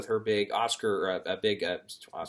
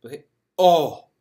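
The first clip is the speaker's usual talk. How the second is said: a hint of a British accent, surprised and louder; British voter accent; 6 semitones higher, 8 dB louder than usual. A voter spots a name on the ballot, lightly British-accented. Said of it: "I'm not gonna do the accent".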